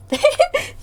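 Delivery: a squeaky, high-pitched giggle. Real, not acted. Laughing at her own peach praise, high giggle. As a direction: let out a high little laugh.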